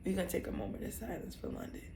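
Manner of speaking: soft, fast hushed words; solemn hush. Real, not acted. Asking a moment of silence for her dog, softly hushed. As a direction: quickly hush it like a solemn tribute. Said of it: "And I'm so serious right now".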